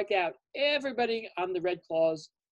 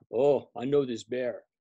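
lower, cocky pitcher sizing up the batter; lower, scheming pitcher talking himself up; pitcher's inner thoughts; 8 semitones lower than usual. Acted. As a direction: muse low and sure of yourself. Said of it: "So he's thinking".

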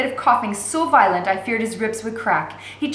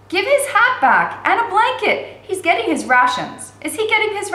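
indignant demand; 11 semitones higher, 5 dB louder than usual. A narrator's reading. Girl demands from jailer.

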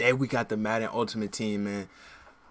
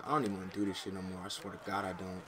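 frustrated muttering; 4 semitones lower, 8 dB quieter, faster than usual. Real, not acted. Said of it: "I'm about to rage".

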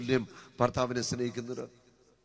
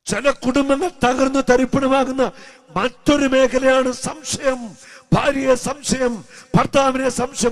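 loud, high, slow pulpit cry; a slow, loud sermon call; preaching at full force; 11 semitones higher, 11 dB louder, slower than usual. Acted. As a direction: drive each duty home to the congregation. Preaching about suspicion.

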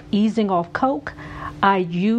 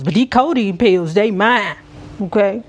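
louder, possessive, punched; possessive emphasis; 5 dB louder than usual. Pretend. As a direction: claim "these codeine pills" loudly as yours. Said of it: "I got to do it".

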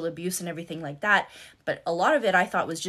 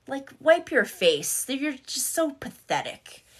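higher, slow mock scolding; pissed-off husband, retold; 7 semitones higher, slower than usual. Husband scolding his crying wife, retold pissed-off contempt. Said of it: "he'd be pissed".

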